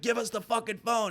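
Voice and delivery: high-pitched mock gunman order; retold pushy demand; imitated demand. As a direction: pitch it up, insistent but not shouted. Armed man demands the phone.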